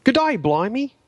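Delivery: high, hammy Australian accent. Shown as a demonstration. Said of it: "let's hear a bit of it then".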